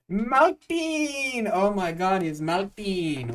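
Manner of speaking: very high, drawn-out holler; hyped, stretched-out cheer; excited shout-out. Real, not acted. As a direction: call the name out loud and long, way up high. Greets someone arriving in chat.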